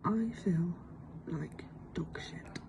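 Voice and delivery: hushed, slow whisper. Real, not acted. Filming at her desk so colleagues won't hear, whispering. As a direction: keep it down so the office can't hear. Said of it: "I'm trying to be quiet".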